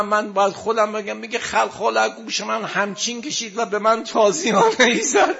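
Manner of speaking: high, loud lament, breaking into sobs; keening elegy with a sobbing voice; wailing mourning sermon. Real, not acted. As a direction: preach the tragedy at full force, weeping between lines. Mourning sermon at full force.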